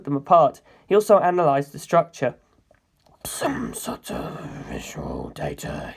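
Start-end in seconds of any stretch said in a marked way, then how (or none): 3.2-5.9 low, slow whispered mutter. Acted. Carr talking to himself over the dismantled computer, low slow mutter. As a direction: murmur it to himself while analysing. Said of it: "he muttered".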